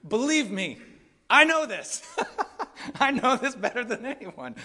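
laughs through the words, pitch up high; chuckling at his own past as an ex-janitor; laughing. Real, not acted. Pastor laughing at himself to the congregation, laughing.

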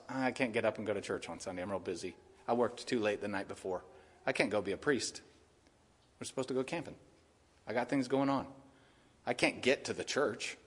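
soft, mopey complaining of a lazy churchgoer; whiny excuses. Retold or imitated. Preacher mimicking lazy believers' excuses, mopey and soft. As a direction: mumble excuses like you can't be bothered. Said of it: "They're saying".

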